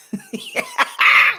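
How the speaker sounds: high-pitched burst of laughter; squealing laugh; high cackle. Real, not acted. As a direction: cackle at your own bit. Laughs after his impression.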